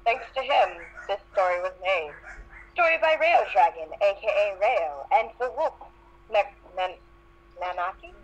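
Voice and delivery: ordinary talk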